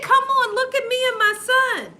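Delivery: high-pitched mock coaxing voice; put-on high voice of a friendly stranger; imitating the lady. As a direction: coax them over in a high voice. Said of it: "This is how she was talking".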